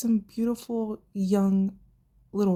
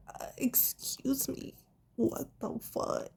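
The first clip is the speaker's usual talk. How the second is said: slow, much softer breathy disbelief; a fan whispering in shocked disbelief at the scene; incredulous whisper; 5 semitones higher, 8 dB quieter, slower than usual. Real, not acted. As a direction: whisper it like you can't believe it. Reacting to Carmela's line.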